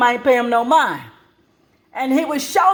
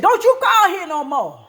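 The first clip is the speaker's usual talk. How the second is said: mother telling off; 7 semitones higher than usual. Retold or imitated.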